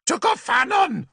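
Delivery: very high ritual shout; druid shout. A narrator's reading. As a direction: cry the god's name to the stars. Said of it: "he had shouted".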